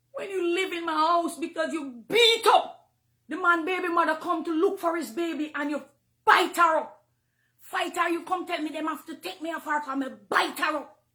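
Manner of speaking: loud, high, furious accusation; angry rant. Real, not acted. Preacher scolding the singer who bit someone, loud and furious.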